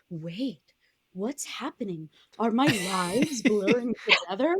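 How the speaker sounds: hushed confusion, rising into puzzled alarm; mildly bewildered character. Acted. Trapped movie character realizing something is wrong, mildly bewildered.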